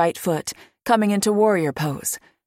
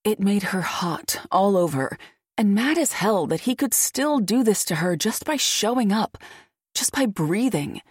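usual speaking voice

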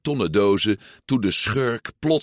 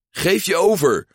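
higher, louder mock sheriff order; 10 semitones higher, 4 dB louder than usual. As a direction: play the toy lawman demanding surrender. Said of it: "zei Woody".

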